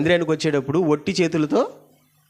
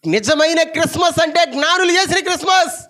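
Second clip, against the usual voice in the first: high, loud preaching cry; preaching at full force; 5 dB louder than usual. Acted. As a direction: proclaim it with fire. (ఉత్సాహంగా బోధన)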